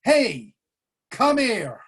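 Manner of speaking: sudden loud, high call across the train car. Retold or imitated. Old man calls loudly to the drunk on the train, loud sharp call. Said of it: "somebody says in a really loud voice".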